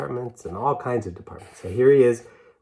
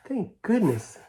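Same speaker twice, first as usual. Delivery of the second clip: ordinary delivery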